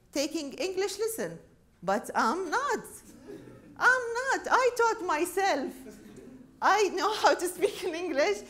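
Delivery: high, loud mock-outraged comeback, key phrases drawn out; indignant retort. Mother arguing back to her son about English lessons, indignant. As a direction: protest proudly, loud and high, word by word.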